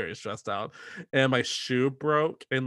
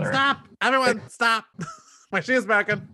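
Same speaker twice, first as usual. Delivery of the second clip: mock frantic yell; 10 semitones higher, 3 dB louder than usual. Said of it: "You didn't stop thirty tappers".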